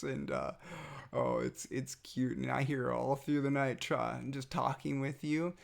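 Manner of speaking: ordinary talk, nothing marked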